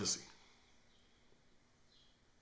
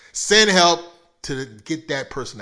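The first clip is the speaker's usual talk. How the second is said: sudden shout; 7 semitones higher, 17 dB louder than usual. Pretend. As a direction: blurt "send help" far louder. Mock plea for rescue.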